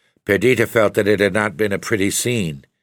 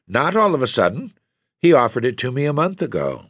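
high, spirited defence; spirited reply; 7 semitones higher than usual. A narrator's reading. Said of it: "cried Perdita with spirit".

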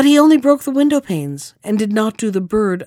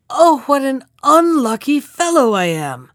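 woeful calling out; 5 semitones higher, 3 dB louder than usual. A narrator's reading. Carter seeing his fresh disaster, calling out woefully. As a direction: call out your misfortune, pitched high. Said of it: "he called out once more".

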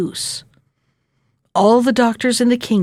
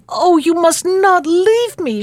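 higher, drawn-out plea with a mild sob; tearful pleading; 8 semitones higher than usual. A narrator's reading. Princess begging the lady not to leave, tearful pleading. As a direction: read it as the princess's distressed cry. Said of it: "she sobbed".

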